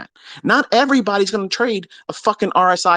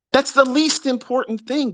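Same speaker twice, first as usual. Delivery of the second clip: "That's the least important thing" pitched much higher; higher exasperated repeat; 9 semitones higher than usual. Shown as a demonstration. Lecturing students who chase drawings, raised pitch. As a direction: say it again, up high in exasperation. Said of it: "repeated point to students".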